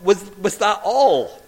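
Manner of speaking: loud, high disbelief; re-enacted amazement, voice leaping up; incredulous outburst. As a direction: blurt it out, astonished it was so trivial. Pupil's relieved disbelief after dreaded summons.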